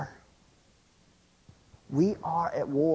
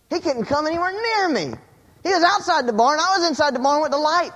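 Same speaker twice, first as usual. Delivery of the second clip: high, loud, thrilled preaching; exultant excitement; 11 semitones higher, 4 dB louder than usual. Retold or imitated. Preacher reliving his realization after the dream, exultant excitement. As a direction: crow it like joyful news, voice way up.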